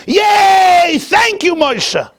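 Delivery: very high, loud joyful shout; crowd cheering. Acted.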